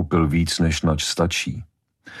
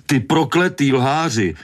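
much higher and slow, a furious yell; outraged shout, drawn out and pitched high; angry roar; 9 semitones higher than usual. A narrator's reading. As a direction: bellow it at a liar down the phone. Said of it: "zařval Von do telefonu".

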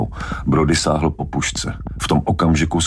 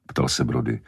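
plain reading voice

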